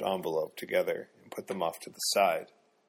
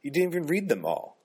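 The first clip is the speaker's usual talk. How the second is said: higher, pleading protest, voice climbing; voice rising; 8 semitones higher, 3 dB louder than usual. A narrator's reading. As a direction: push back as the voice rises in pitch. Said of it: "her voice rising".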